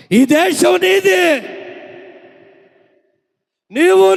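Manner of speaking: high, loud, slow shouted proclamation; preaching at full force. Acted. Preacher booms God's words to the church, shouting slowly. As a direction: thunder God's promise to the congregation.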